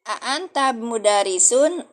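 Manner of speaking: "A anta mudarisun" drawn out slowly, each word clear; Arabic question read slowly. Shown as a demonstration. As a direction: say "A anta mudarisun" like a teacher modelling. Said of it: "Apakah kamu seorang guru?". (mengucapkan "A anta mudarisun" pelan dan jelas)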